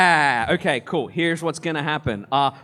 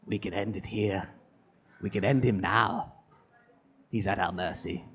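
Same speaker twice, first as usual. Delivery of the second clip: hag voice; 7 semitones lower, 5 dB quieter than usual. Acted. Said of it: "The hag says".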